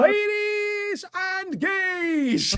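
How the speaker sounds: high, hugely drawn-out ringmaster call; mock announcer. Barista announcing the Dilf to ladies and gays, booming announcer. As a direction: announce him grandly to the crowd, stretching words.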